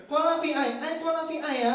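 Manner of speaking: high, insistent mock Vietnamese accent; Vietnamese-accented order. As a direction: imitate a Vietnamese customer repeating an order. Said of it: "Dia pesan Coca-Cola". (logat Vietnam bernada tinggi, mengulang pesanan)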